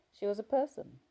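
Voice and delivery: ordinary delivery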